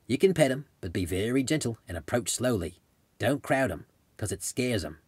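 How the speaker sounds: gentle Australian accent to a little girl, lightly voiced; a touch of Aussie accent, coaxing a child. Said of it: "in his Australian accent".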